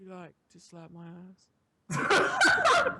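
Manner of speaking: slow, put-on smooth British come-on; smooth British whisperer. Acting the imagined smooth whisper, slow put-on voice. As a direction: stretch it out as a smooth British seducer. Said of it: "like, a fucking British voice".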